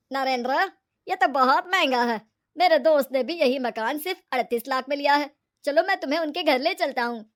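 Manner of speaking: loud, forceful put-on man's voice; indignant brother-in-law. Brother-in-law telling Narendra he paid too much, indignant. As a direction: protest loudly about being overcharged.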